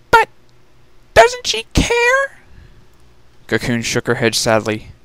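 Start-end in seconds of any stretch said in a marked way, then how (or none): 0.1-2.4 much higher, small pleading filly voice; beg, close to tears, tiny voice; frightened little sister. Acted. Youngest sister begging not to be sent alone, much higher and pleading.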